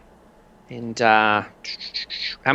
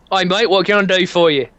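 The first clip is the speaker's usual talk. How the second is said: lightly put-on British greeting; a cheery British shopkeeper welcome; British accent; 7 semitones higher, 4 dB louder than usual. Security shop owner greeting the customer in a British accent. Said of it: "can't do this accent very well".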